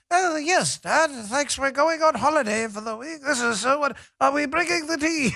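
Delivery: high, hammed-up British child; prim Peppa Pig-style British imitation; mock British accent. Kid answering dad about holiday, high mock British accent. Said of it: "now speaking in British accents".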